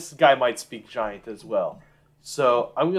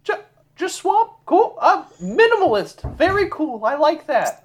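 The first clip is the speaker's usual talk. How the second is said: much higher, overly polite voice; polite giant speech; 12 semitones higher than usual. Acted. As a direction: play an eager host making small talk. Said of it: "This is in giant".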